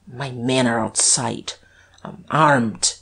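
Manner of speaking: plain reading voice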